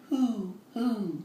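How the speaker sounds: hoo like an owl; hooting owl. Shown as a demonstration. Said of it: "Can you sound like an owl?".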